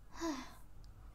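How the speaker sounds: quiet, falling sigh; soft sigh. Acted. Vampire after mother's forbidden tale, soft sigh. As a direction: sigh it out softly.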